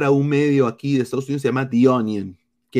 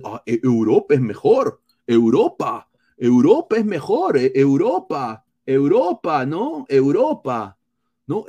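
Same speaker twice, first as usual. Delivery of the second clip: mocking agents. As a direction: parody agents nagging him to leave. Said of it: "Escuchar a la gente ahí".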